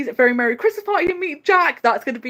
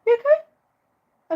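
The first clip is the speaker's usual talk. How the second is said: tender pet voice; 13 semitones higher, much slower than usual. Real, not acted. Host asking her sneezing cat if it's okay, tender and high. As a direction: coo at the cat to make sure it's fine.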